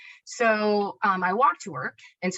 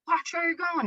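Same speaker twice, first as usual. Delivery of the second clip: imitated irritation at a careless driver, pitched much higher; higher, mock annoyed jab at the driver; mock angry cussing; 11 semitones higher than usual. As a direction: toss off a pretend telling-off, not really shouted. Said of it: "cussing this guy out in my head".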